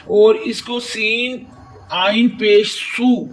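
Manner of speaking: सीन आइन पेश सू read slowly letter by letter; slow letter-by-letter spelling of सू; spelled recitation. Shown as a demonstration. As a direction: sound out सीन आइन पेश सू like a Quran teacher. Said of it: "बगैर खींचे पढ़ेंगे".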